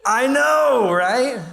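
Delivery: bright burst of shared delight. Real, not acted. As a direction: share the joy of the cute photo. Answering the audience's reaction.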